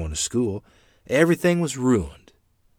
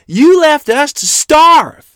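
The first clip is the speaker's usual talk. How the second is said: high, drawn-out accusing shriek; 14 dB louder, slower than usual. A narrator's reading. Mother accusing the man who left them to starve, shrieking. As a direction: shriek it slowly at full voice. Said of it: "Mother shrieked at him".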